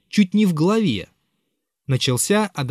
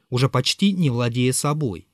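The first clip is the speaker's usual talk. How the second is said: plain reading voice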